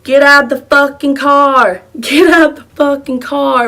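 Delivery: loud, high, drawn-out yell; slow mock-aggressive shout; retold shouted demand. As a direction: imitate someone ordering people out, slow and loud. Retold women demanding they get out.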